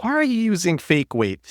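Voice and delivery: imitating a nervous gym newbie, pitched high; timid high voice. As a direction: put on a high, innocent questioning voice. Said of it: "always talk in this high accent and seem very nervous".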